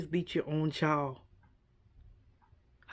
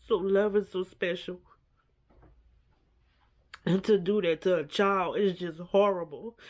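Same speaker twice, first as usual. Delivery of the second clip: normal talking voice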